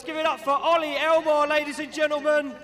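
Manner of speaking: hyped arena announcer; big ringside introduction; announcer intro. Real, not acted.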